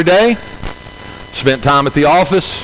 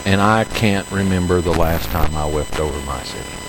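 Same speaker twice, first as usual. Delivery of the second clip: hushed confession; 7 semitones lower, 7 dB quieter than usual. Retold or imitated. Pastor confessing to the congregation, softer and lower with quiet ache.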